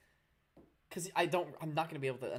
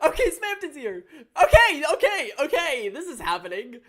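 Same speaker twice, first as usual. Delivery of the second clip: higher, louder, laughing through it; giggling disbelief as the character shows up; laughing excitedly; 11 semitones higher, 15 dB louder than usual. Real, not acted. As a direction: streamer cracking up at a surprise. Laughing reaction to surprise.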